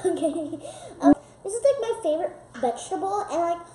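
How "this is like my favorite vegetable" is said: usual speaking voice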